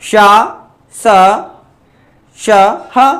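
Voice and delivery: शा, स, श, ह each released on the breath with the mouth a little open; sounding out sounds. Shown as a demonstration. Teacher showing the mouth opens a little, hissed शा स श and breathy ह. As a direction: hiss शा स श, then breathe ह. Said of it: "थोड़ा थोड़ा खुलता है ना मुंह".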